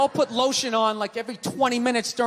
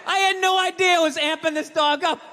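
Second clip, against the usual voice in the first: ordinary talk, nothing marked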